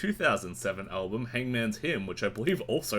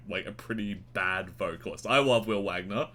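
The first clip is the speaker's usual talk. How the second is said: normal talking voice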